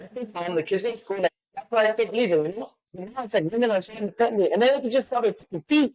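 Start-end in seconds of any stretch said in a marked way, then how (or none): none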